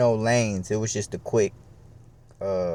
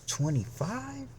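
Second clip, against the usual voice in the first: much higher, slow, amazed question; delighted disbelief; 13 semitones higher, 8 dB quieter, slower than usual. Retold or imitated. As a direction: squeal it out in amazement. Bigger disbelieving echo.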